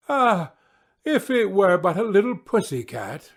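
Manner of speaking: put-on high, wistful young princess. A narrator's reading. Princess hoping the casket holds a kitten, wistful. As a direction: sigh it out like a spoiled princess.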